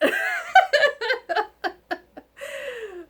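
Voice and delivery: bright high burst of laughter. Real, not acted. Laughing at herself.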